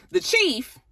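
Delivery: ordinary delivery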